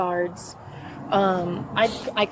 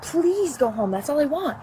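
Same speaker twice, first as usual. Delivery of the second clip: higher-pitched exasperated plea; mildly imploring quote, pitched up; retold pleading; 8 semitones higher than usual. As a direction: beg them to leave, voice lifted. Retold plea during a quarrel.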